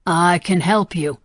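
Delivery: slow, steady reassurance; calm. Acted. Senator repeats his offer of help to the gunman, calm. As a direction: say it slowly to calm him. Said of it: "he said calmly".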